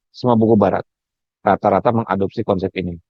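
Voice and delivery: ordinary delivery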